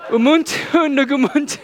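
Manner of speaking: high-pitched, hammed-up soulful chant. Shown as a demonstration. Pastor showing the congregation how to say the saying, with soul. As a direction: belt out the foreign saying with exaggerated soul. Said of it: "say it as if you have soul".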